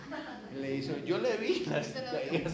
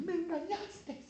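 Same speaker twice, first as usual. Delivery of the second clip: high, soft whiny falsetto; mock feminine pout, high and small; wounded reproach; 11 semitones higher, 7 dB quieter, slower than usual. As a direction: whine it like a hurt girlfriend. Dalila reproaches Sansón after failed trick.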